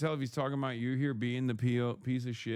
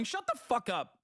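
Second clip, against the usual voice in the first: high angry snap; 8 semitones higher than usual. Real, not acted. Snapping at the chatter.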